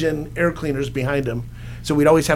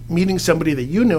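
normal talking voice

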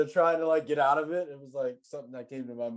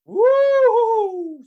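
high, loud ghost howl woo; ghostly woo; 23 dB louder than usual. Acted. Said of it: "you sounded like a Halloween ghost".

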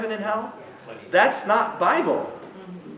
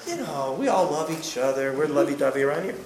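mock easygoing Jesus; 4 semitones lower, 6 dB quieter than usual. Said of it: "he's not gonna say".

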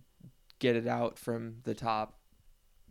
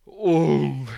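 loud exasperated oh; frustrated groan; 3 semitones higher, 11 dB louder than usual. Real, not acted. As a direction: let out a fed-up groan. Frustrated exclamation in story.

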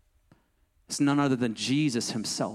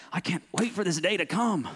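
a light retelling of eager impatience, pitched up; lightly acted impatient fiancé, higher; impatient groom; 5 semitones higher than usual. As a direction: sigh with mock eagerness for the wedding day. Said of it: "I'm like".

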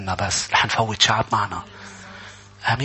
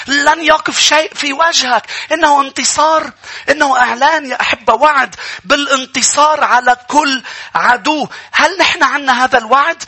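full-force preaching; 11 semitones higher, 5 dB louder than usual. Real, not acted. Preacher announcing a promise of victory to the congregation, full-force preaching. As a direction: declare victory to the crowd at full pitch.

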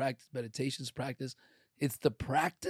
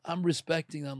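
ordinary delivery

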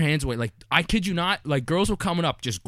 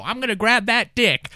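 lightly voiced old lady, higher pitch; 7 semitones higher, 4 dB louder than usual. Retelling an old lady's brazen threat, higher and slow. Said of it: "I hear some old lady go".

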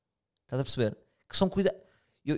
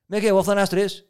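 loud, higher mock group call-out; brusque impression; 6 semitones higher, 7 dB louder than usual. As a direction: imitate a blunt colleague rallying everyone. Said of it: "É bruto, parece que é assim". (imitação bruta, alto e agudo)

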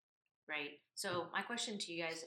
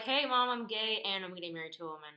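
mock announcement; 3 semitones higher, 10 dB louder than usual. Imagined coming out to Mom, mock announcement.

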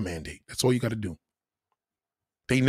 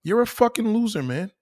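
usual speaking voice

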